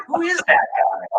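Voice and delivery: high, slow baffled ask; who on earth is that; puzzled question. Retold or imitated. Asking Steve about the stranger, baffled. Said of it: "And I said".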